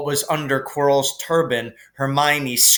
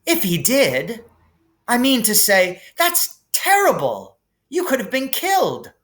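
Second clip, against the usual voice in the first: indignant outburst; 7 semitones higher than usual. Acted. Hermione outraged at Dumbledore, high indignant outburst. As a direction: explode in shocked protest. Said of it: "Hermione exploded".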